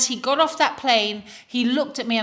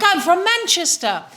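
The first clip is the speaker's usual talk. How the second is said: high, loud, emphatic imitation; imitated seatmate; 9 semitones higher, 5 dB louder than usual. Seatmate answering where he is from, high-pitched mimicry. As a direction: throw it back high and loud, mimicking him.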